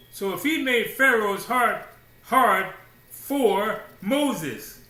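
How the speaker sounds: loud, high, ringing pulpit declaration; preaching at full force. Real, not acted. Preacher hammering the point to the congregation, full voice. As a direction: drive it home to the congregation.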